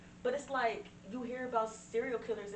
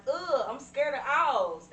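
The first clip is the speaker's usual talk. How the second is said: quoting the clip; 6 semitones higher, 10 dB louder, much slower than usual. Retold or imitated. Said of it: "I quoted it and was like".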